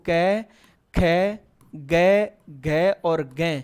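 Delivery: कै, खै, गै drawn out very slowly; slow sounding out. Shown as a demonstration. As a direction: stretch each कै खै गै syllable. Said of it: "आप देखो".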